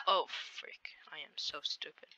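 whispered, slow at first, to himself; self-scolding whisper. Real, not acted. Gamer whispering at his own mistake. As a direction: hiss it under your breath at yourself.

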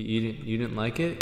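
ordinary delivery

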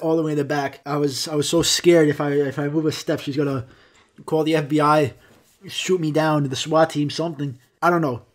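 ordinary talk, nothing marked

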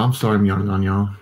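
ordinary delivery

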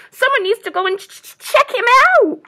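fast, high, loud stammer on CHECK; chattering squirrel. A narrator's reading. Squirrel urges animals to investigate stranger, chattering. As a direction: chatter urgently, tripping on the first sound. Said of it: "chattered the squirrel".

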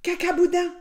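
high, loud blurt; cheeky shout. A narrator's reading. Wolf replies to the doctor examining him, cheeky shout.

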